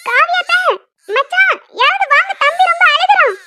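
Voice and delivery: high, urgent call through the house; calling for help. Acted. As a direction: shout worriedly for someone to come. Calling family for help with baby.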